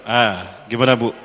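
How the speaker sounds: normal talking voice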